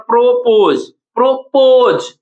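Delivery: Propose with a long, drawn-out "poz"; propose in two parts, weight on "poz"; stress on second syllable. Shown as a demonstration. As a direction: stretch the second half of propose. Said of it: "पोज हमारा लंबा समय खींच रहा है".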